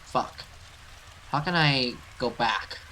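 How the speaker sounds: ordinary talk, nothing marked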